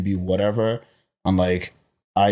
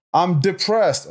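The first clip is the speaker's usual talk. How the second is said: high, louder declaration; mock announcement; 10 semitones higher, 4 dB louder, much slower than usual. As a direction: announce it openly like big news. Acted confession met with rejection.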